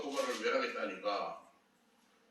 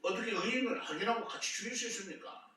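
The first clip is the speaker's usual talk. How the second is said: acted protest, pitched higher; argumentative challenge; 6 semitones higher than usual. Abraham disputing with God over the righteous, argumentative. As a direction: argue it out as a rhetorical challenge. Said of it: "따지는데".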